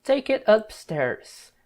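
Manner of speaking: "Take it upstairs" stretched slowly; slow model sentence; slow line demo. Shown as a demonstration. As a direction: read "Take it upstairs" slowly so students catch it. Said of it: "como a gente falaria assim".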